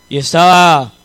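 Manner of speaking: normal talking voice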